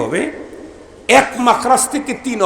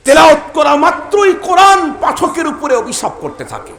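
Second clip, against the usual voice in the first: repeated higher and louder, hammering it home; loud warning; 11 semitones higher, 4 dB louder than usual. Acted. Preacher warning careless reciters of the Quran, shouting. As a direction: call out the warning at full force.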